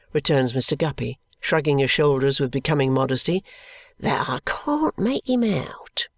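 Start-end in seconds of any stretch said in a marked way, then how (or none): 4.0-6.1 whispered, slow and secretive; confiding whisper. Acted. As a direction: lean in and whisper it. Guppy confides about the old man.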